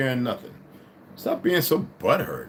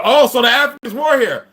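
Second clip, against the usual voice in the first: mocking quote; 11 semitones higher, 8 dB louder than usual. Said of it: "And they're like".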